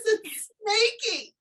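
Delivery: lightly voiced child, slow and high; imitating the boy. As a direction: say the pet's name proudly, like a kid. Boy names his snake.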